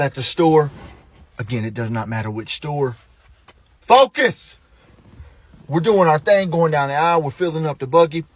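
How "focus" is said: loud, high bark; sudden shouted demand; sharp command. Shown as a demonstration. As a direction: snap the listener to attention. Second, sharper demand to focus.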